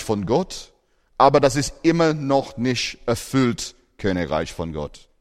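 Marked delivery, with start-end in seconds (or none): none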